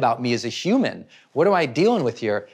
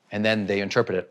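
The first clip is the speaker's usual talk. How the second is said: ordinary delivery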